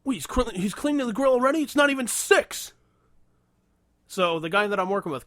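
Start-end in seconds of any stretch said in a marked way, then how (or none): 0.0-2.7 loud, high-pitched mock indignation; mocking snide complaint. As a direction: imitate a scandalized tattletale coworker, pitched up. Mimicking the snide coworker's complaint.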